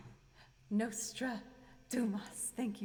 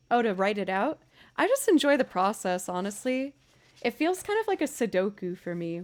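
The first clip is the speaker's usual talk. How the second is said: ordinary talk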